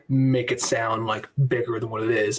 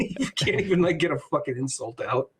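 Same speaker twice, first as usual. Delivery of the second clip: ordinary talk, nothing marked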